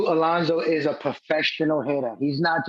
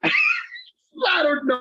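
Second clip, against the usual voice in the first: laughing; much slower than usual. Real, not acted. Laughs at the question.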